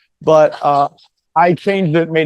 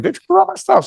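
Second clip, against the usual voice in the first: very high-pitched retold protest; mock complaint. Dad telling the cops about three guys, high mock complaint. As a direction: put on a high, wronged voice.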